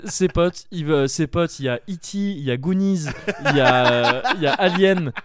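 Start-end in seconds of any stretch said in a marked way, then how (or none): 2.2-5.1 laughing. Real, not acted. Listing films, laughing.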